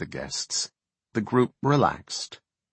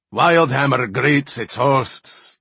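higher, louder, slow jolly roar; cheerful roar; 5 semitones higher, 6 dB louder, slower than usual. A narrator's reading. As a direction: boom a hearty greeting. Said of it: "roared cheerfully".

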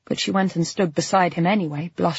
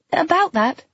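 raised, lively woman's voice; Claire's voice; 11 semitones higher, 4 dB louder than usual. A narrator's reading. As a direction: lift it into the lighter female character. Claire agreeing, voiced high.

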